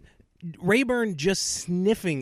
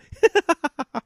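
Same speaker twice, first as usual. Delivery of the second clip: giggle; 10 semitones higher, 7 dB louder than usual. Retold or imitated. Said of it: "she's just sitting there going".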